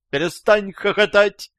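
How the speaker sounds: high-pitched female squeal; женский визг. A narrator's reading. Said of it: "взвизгнул со стороны женский голос". (визгливый женский окрик)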